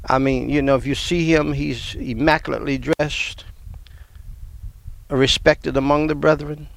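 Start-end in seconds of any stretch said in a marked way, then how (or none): none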